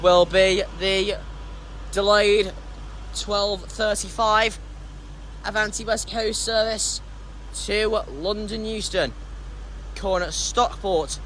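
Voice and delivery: slow, measured station announcer voice; station announcement. Pretend. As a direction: read it like a platform PA. Reads out departure like station PA.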